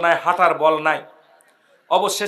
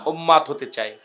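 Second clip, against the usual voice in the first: ordinary delivery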